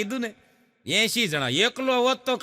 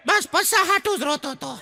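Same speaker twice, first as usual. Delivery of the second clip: put-on child voice; 8 semitones higher than usual. Crying boy answers, laughing.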